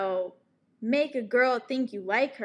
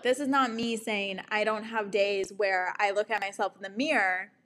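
ordinary delivery

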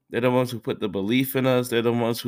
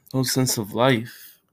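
tearful; slower than usual. Real, not acted. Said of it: "I gotta stop crying".

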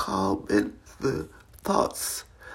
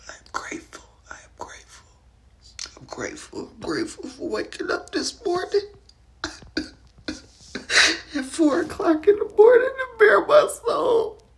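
whispered prayer; 7 semitones higher than usual. Real, not acted. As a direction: pray it under your breath, grateful.

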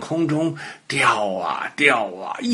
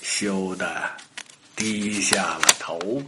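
slow, low and softer; soft, rueful narration; 6 semitones lower, 6 dB quieter than usual. A narrator's reading. Storyteller tells of boastful dog's shame, softly rueful. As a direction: let it sink down slowly and quietly.

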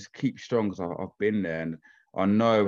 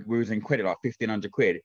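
normal talking voice

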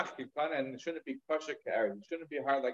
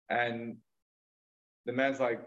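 ordinary talk, nothing marked

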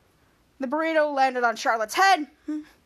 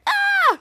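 high loud startled scream; 6 dB louder than usual. Retold or imitated. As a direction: shriek in fright. Jake screams in retold scene.

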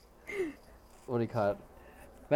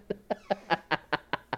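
laughing; 5 semitones higher than usual. Real, not acted. Laughs about Filipino accent.

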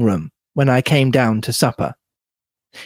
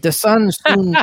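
high European mad scientist voice; Doctor Bauerstein; 7 semitones higher than usual. Acted. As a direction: pitch it up with a European accent. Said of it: "European mad scientist".

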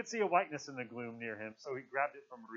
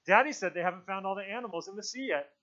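raised, higher voice, blurting it out; loud outburst; 7 semitones higher, 5 dB louder than usual. A narrator's reading. Maurice breaks into the boys' meeting, loud outburst. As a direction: speak up suddenly, loud and high. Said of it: "Maurice spoke so loudly that they jumped".

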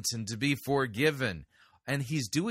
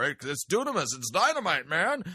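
high, loud, over-excited preacher mimicry; mock excited preacher; 6 semitones higher, 3 dB louder than usual. Host mocking the preacher's word study, mock excited. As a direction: parody a hyped-up preacher, pitched high and punchy. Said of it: "saw the word power".

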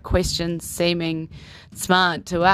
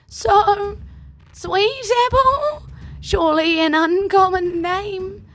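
put-on much higher, bright young woman; high girl voice; 12 semitones higher, 4 dB louder than usual. Kyra questions the horse.

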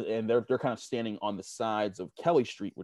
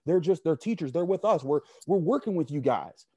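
pleading with the officer; 7 semitones higher than usual. Retold or imitated. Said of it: "the rest of us like".

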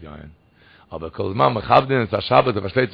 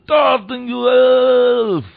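loud, high, drawn-out cry; 10 semitones higher, 8 dB louder, much slower than usual. Retold or imitated. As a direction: imitate a plea shouted to heaven, holding the last word. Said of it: "dann schreit er".

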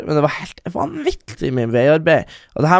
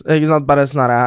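normal talking voice

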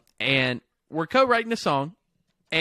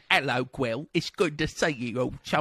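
lower, chummy British greeting; mock British accent; 4 semitones lower, 3 dB quieter than usual. American greeting Welsh friend, badly faked British accent. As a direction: greet a pal in fake British. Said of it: "Do your best British accent".

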